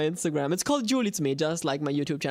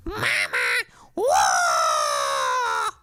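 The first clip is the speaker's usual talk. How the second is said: Toad scream; 11 semitones higher, 4 dB louder, much slower than usual. Pretend. Toad screams for Mario, loud and wild. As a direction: let out a huge scream into the mic. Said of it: "I'm gonna scream in the microphone".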